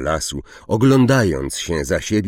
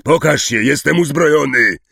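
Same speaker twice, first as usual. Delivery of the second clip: loud, high-pitched armed warning; forceful threatening call; 9 semitones higher, 8 dB louder than usual. A narrator's reading. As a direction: shout a challenge at someone hidden. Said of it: "zawołał Hagrid".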